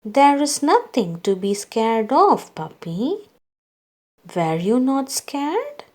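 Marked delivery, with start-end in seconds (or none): none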